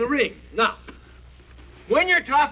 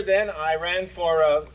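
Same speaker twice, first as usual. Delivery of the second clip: ordinary talk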